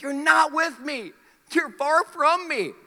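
slow, loud, high divine rebuke; God pressing the point slowly; God's stern reply. Acted. God answers leaders.

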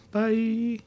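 lightly voiced high goodbye for her; high, mock sign-off in her place; voicing Andrea. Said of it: "in place of Andrea, I'll do it for her".

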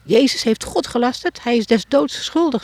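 a mild mock shout of the priest; retold high priest's cry. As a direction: call it out like the outraged priest. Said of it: "Hoor je wat hij schreeuwt?".

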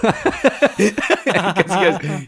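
high, loud burst of laughter over the words; laughing hard. Real, not acted. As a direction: crack up mid-sentence.

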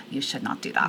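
a hint of a German accent, stern and flat. Imitating a blunt German staffer in a German accent. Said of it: "In, like, a German accent".

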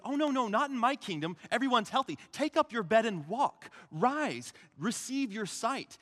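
high, loud healing proclamation; triumphant command. Acted. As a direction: proclaim healing at full preaching force. Jesus acted, commanding the sick healed.

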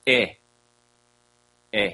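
e as the short first vowel of "etymology"; short E sound. Shown as a demonstration. Teacher showing grave-accented E, e said short as in "etymology". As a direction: say e as a clipped eh, not the letter name ee. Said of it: "as in etymology".

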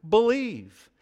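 "believe" drawn out slowly after a lead-in; stress on believe. Shown as a demonstration. Preacher shows which word Jesus emphasized, stressing "believe". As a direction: set "believe" apart, slow and weighty. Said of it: "added the inflection and emphasis on the".

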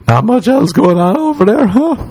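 very high, squeaky mocking tease; mock teasing. Comedian teasing a pretty girl in a squeaky mock voice. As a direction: tease her in a silly high voice.

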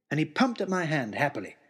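ordinary delivery

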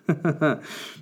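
high burst of laughter; real laugh at the story. Real, not acted. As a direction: break into a laugh. Laughs at creepy quote.

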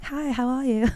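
put-on London accent. Imagining coming home from London, mock London accent. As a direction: put on an affected London greeting. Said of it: "imagine if I lived in London".

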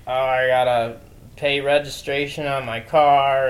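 ordinary talk

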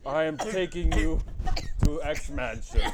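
big comic Russian superhero voice; Colossus. Pretend. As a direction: boom it out in a mock Russian accent. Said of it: "say that in a Russian accent".

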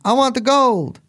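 leprechaun video quote. Retold or imitated. Host quoting the leprechaun video to his cohost. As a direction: quote the leprechaun gold line in a squeaky voice. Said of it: "You know what I'm talking about?".

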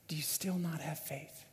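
near-whisper, very soft; hushed questioning. Acted. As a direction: let the question sink in, hushed. Jesus's searching question closes the story.

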